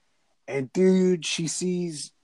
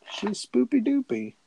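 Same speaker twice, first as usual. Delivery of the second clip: much higher playful singsong; silly singsong; 10 semitones higher than usual. Acted. Joking with her cohost in a silly high singsong. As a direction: toss it off like a baby-talk rhyme.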